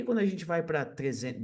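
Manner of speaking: usual speaking voice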